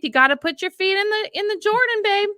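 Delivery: high, fast, eager urging; chirpy push to just leap in faith; mock urging. Wife urging her calm husband to step out in faith, high and eager.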